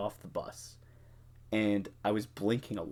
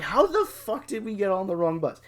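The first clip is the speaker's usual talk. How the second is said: much higher, louder disbelieving outburst; how could you possibly, pitched way up; incredulous; 10 semitones higher, 5 dB louder than usual. Retold or imitated. Narrator demanding of drunk friends how, in loud disbelief.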